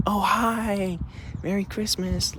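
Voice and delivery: high, soft cheery hello; friendly greeting. Retold or imitated. Passers-by greeting the dog walker, friendly. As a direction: imitate a friendly stranger's lilting greeting. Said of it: "they were so friendly".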